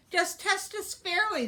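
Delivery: high pleading protest; indignant exclaim. Acted. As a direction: protest sharply. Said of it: "they exclaimed".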